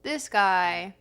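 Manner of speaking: "This guy" with the TH hardened to a D; South Yorkshire "This guy", starting on a D. Shown as a demonstration. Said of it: "using the D for the T sound".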